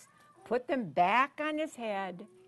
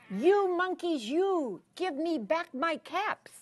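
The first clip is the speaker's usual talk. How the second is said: higher-pitched scolding of naughty monkeys; scolding peddler; 6 semitones higher than usual. A narrator's reading. Peddler to monkeys in the tree, mock scolding. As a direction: scold them with drawn-out YOUs. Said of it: "he spoke to them. ... shaking his fingers".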